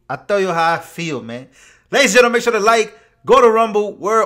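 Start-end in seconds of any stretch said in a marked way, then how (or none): 1.9-4.3 loud, high, fast call to action. Real, not acted. Host urging viewers to like and follow, calling out loud. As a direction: belt out the plug with urgency.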